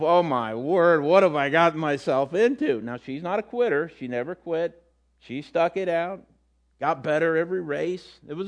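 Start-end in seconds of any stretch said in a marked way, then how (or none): 0.0-2.8 overwhelmed daughter. Retold or imitated. Daughter at the finish line, lightly voiced dismay. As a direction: sigh it out, stunned at what you started. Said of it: "That look of".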